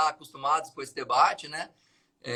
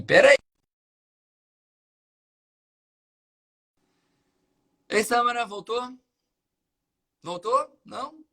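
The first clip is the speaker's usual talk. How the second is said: raised, higher calls into a dead line; to someone hard of hearing; 6 semitones higher, 6 dB louder than usual. Real, not acted. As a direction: call out to check she's still there. Call drops, guest can't hear.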